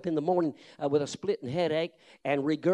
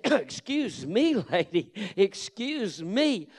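slow, wary excuse me; 3 semitones higher, much slower than usual. Retold or imitated. Preacher pushing off the woman.

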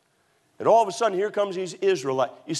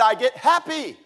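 happy; 8 semitones higher, 4 dB louder than usual. Retold or imitated. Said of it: "when they would get happy".

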